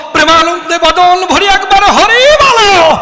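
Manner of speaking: loud, high call to say Hari; calling out to the crowd. Real, not acted. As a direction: rouse the whole audience to shout Hari. Calling audience to chant Hari. (শ্রোতাদের হরি বলতে ডাকা, উঁচু গলায় চিৎকার)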